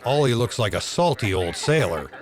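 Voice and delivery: ordinary talk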